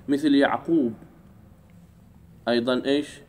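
usual speaking voice

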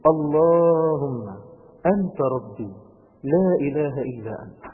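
slow, drawn-out, reflective recitation; slow and gentle. Shown as a demonstration. Preacher showing youths how to recite it slowly. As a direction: savour each word slowly. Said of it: "أنا عايز بس بالراحة كده".

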